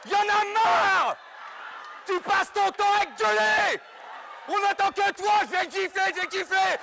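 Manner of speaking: very high, full-force angry yell; exasperated screaming. Acted. Fed-up helper snapping at his shouting colleague, exasperated screaming. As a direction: explode and out-shout the other man. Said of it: "je peux crier!".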